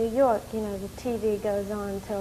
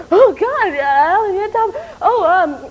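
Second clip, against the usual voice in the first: much higher and louder, gasping in shock; hysterical shriek; 9 semitones higher, 12 dB louder than usual. Retold or imitated. Retelling the phone call, shrieking his name in hysterical shock. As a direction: squeal the name like you can't believe it. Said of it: "I got very hysterical".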